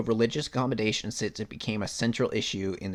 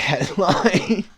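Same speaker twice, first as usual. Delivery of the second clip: laughing; 8 semitones higher, 9 dB louder than usual. Real, not acted. Laughing at the headline.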